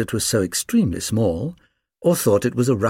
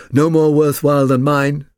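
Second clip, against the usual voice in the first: loud, raised-pitch retort; big-voiced sheepdog character; boastful sheepdog puppy; 6 semitones higher, 7 dB louder than usual. A narrator's reading. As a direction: cut in loudly as a proud puppy.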